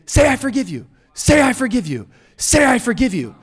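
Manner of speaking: high, insistent repeated demand; badgering, each repeat punched out alike; berating. Churches pressing hurt people to forgive, berating. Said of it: "we can sort of berate you".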